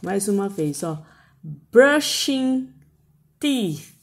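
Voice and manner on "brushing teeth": "brushing" louder, "teeth" with tongue between teeth; slow clear English model. Shown as a demonstration. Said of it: "sonzinho com a língua entre os dentes". ("brushing teeth" lento, com a língua entre os dentes)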